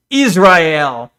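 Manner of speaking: Israel said as "iz-rye-EL"; imitated pronunciation. Streamer showing viewers how she says it, imitated pronunciation. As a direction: repeat Israel slowly as three syllables, iz-rye-el. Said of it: "when she says Israel".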